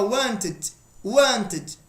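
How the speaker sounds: "wanted" with a near-silent final t; pronunciation demo. Shown as a demonstration. Teacher showing students how "wanted" ends. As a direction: clip the end of "wanted" almost to a mute t. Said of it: "E na pronúncia fica assim, ó".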